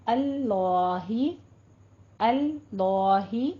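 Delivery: "अल्लाही" slow with a thick, rounded "lo"; heavy drawn-out "अल्लाही"; full, heavy l. Shown as a demonstration. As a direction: fill the mouth on the double l of "अल्लाही" and stretch it. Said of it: "पोर पढ़ेंगे".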